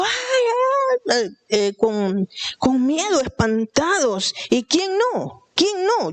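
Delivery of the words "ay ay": very high shrieked ay ay of panic; frightened cry. Retold or imitated. Disciples on the boat screaming in fright at a ghost. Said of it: "Ya me imagino yo a ellos gritando".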